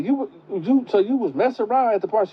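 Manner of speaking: high and fast, suspicious; grandma quizzing the girl about the party, high and fast; accusing grandma. Retold or imitated. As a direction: fire the question at her, pitched high and quick. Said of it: "She was like".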